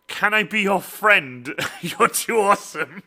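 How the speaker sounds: high, loud, slow and laughing; drawn-out playful adoration; gushing fan question. Retold or imitated. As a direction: read it out gushingly, breaking into laughter. Reads fan's gushing question, laughing.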